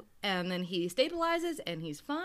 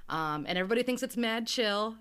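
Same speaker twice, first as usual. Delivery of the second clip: normal talking voice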